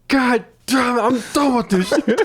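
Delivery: high, hammed-up exasperation; mock fed-up outburst; fed-up man character. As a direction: act fed up, breaking into laughter.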